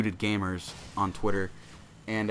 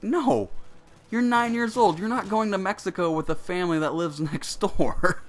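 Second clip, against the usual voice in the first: mom refusing; 9 semitones higher than usual. Retold or imitated. Mom refusing her nine-year-old's trip, mock firm no. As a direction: shut the kid down like an exasperated mom. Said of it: "And she's like".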